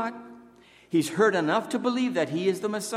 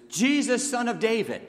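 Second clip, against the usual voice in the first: higher and louder, crying out to Jesus; calling out; 6 semitones higher, 4 dB louder than usual. Retold or imitated. Blind beggar calls out to Jesus, loud and high. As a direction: beggar straining to be heard above the crowd. Blind Bartimaeus responds and says.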